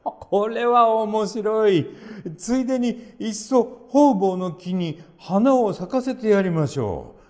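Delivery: put-on high, slow, pleased old man voice; a happy old grandfather marvelling; delighted old man. A narrator's reading. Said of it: "手を叩いて喜びました".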